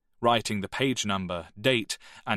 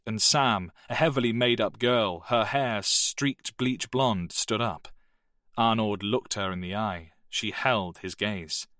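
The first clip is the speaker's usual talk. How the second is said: ordinary delivery, nothing marked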